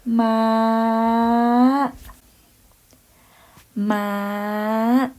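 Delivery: "Ma" pitched high and held level; Thai high tone. Shown as a demonstration. Teacher showing students the high tone, pitched high. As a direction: lift "Ma" high and keep it up. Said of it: "high tone".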